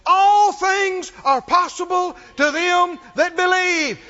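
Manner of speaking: loud, high, slow preaching shout; emphatic proclamation. Real, not acted. Preacher declaring Jesus' words to the church, emphatic proclamation. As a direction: proclaim it word by word at full voice.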